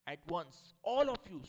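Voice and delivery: loud, slow order to stop; angry command. Acted. Mother ordering the laughing children to stop. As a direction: bark it at the whole room. Mrs Asgar stops laughter.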